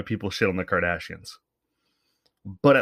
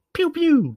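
1 mock gun sound; 4 dB louder than usual.

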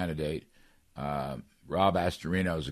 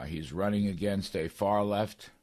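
ordinary delivery

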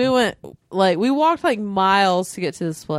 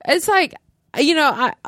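normal talking voice

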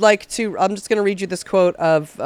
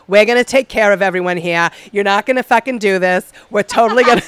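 imitated Boston accent, fired up; rallying speech in a mock Boston accent; 3 dB louder than usual. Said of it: "She's a fucking Boston accent".

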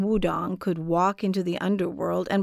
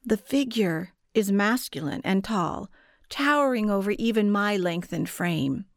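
usual speaking voice

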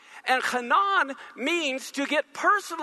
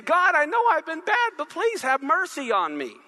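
David pleading; 5 semitones higher, 3 dB louder than usual. Acted.

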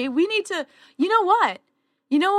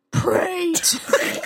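slow, gravelly-attempt mock Batman; 5 semitones higher, much slower than usual. Shown as a demonstration. [name] tries a Batman line for Bri, very slow. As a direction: drag it out like a bad Batman. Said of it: "I'm not good with voices".

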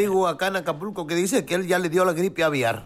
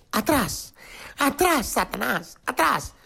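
higher, slow, commanding; mother superior's voice; 6 semitones higher, slower than usual. Acted. Mother superior warding off the vampire, slowly. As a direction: banish him like a brave nun. Nun confronting vampire with crucifix.

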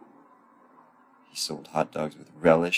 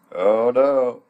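mock dismay; 6 semitones higher, 4 dB louder than usual. Mock dismay as car stops.

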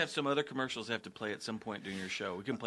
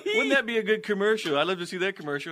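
high, loud retold suggestion; loud, high mock eagerness; mock quote; 9 semitones higher, 8 dB louder than usual. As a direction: quote them loudly, pitched way up. Producer mock-quotes hosts, pleading his case.

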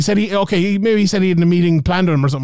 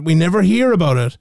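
normal talking voice

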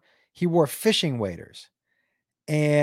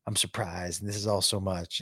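softly confiding a pretend game; low, hushed quote of a partner explaining; understated, much softer aside; feigned surprise; 8 semitones lower, 8 dB quieter than usual. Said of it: "pretend like".

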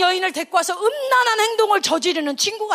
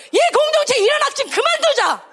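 high, forceful call to stop; acted righteous indignation; impassioned outcry; 10 semitones higher, 4 dB louder than usual. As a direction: cry out to the whole community. Preacher voices Phinehas's outrage.

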